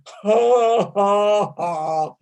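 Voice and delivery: big open-mouthed ha, ha; open ha laugh sounds. Shown as a demonstration. Teacher leading a Zoom class, open-mouthed ha. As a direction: belt out a ha from the heart. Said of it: "And again".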